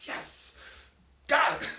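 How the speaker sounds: loud joyous cheer; triumphant yes. Retold or imitated. Dad cheering when his daughter finally laughs, loud and triumphant. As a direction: punch the air with it.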